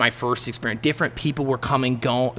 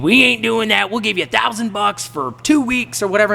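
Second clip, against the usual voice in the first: raised, pushy haggler's voice; haggling; 10 semitones higher, 4 dB louder than usual. Retold or imitated. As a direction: act out driving a hard bargain, higher pitched. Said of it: "haggling with this dude".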